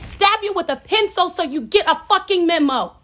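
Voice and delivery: loud, high shouted lyric; hyped lyric shout. Retold or imitated. Vlogger hyping the [name] lyric, loud high shout. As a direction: shout the line like the hype track. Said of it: "She said".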